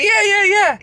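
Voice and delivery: imitating excited kids answering grandma. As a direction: chirp it like thrilled children. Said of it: "And we say".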